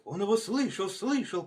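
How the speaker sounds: high-pitched eager echo; excited repeating. A narrator's reading. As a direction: pass it on excitedly. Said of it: "повторяли лиса и волк".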